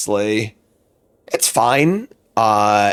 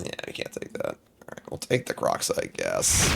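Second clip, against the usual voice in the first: whispered musing; 11 dB quieter than usual. Real, not acted. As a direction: whisper it while deciding. Muttering while picking.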